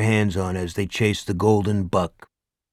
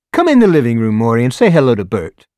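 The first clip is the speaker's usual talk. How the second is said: eager neighbor; 2 semitones higher, 7 dB louder, faster than usual. A narrator's reading. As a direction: coax him into the living room. Neighbor invites Morris in.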